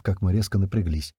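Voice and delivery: ordinary delivery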